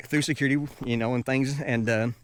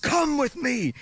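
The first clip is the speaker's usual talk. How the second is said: high-pitched barked order; mock-yell it like an angry guard; imitated angry yell; 12 semitones higher, slower than usual. Angry guard orders traveler along, imitated yell. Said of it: "yelling at me at this moment".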